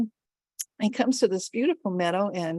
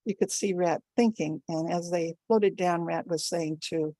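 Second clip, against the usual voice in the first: plain reading voice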